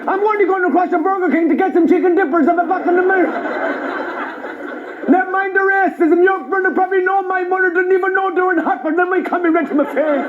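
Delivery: indignant mock Traveller rant; imitated Traveller accent. Comedian goes all in on Travellers, ranting in their accent. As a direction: pile up grievances in a Traveller accent. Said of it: "answered them in their accent".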